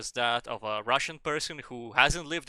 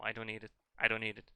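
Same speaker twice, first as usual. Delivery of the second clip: ordinary talk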